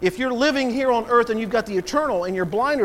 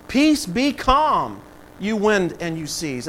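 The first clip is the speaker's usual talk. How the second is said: slow, drawn-out command to a storm; acted command, solemn and slow; Jesus; slower than usual. Voices Jesus commanding wind and seas.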